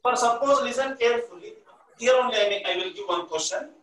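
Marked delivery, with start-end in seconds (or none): none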